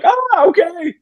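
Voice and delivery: a mock-timid pastor giving in to the wife; meek surrender. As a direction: cave in with a high, sheepish yes.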